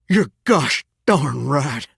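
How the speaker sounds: slow, weighted GOSH DARN RIGHT; emphatic mild cussing. Acted. Shower agrees mid-gripe, slow emphatic Southern cussing. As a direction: punch and stretch each word of "gosh darn right".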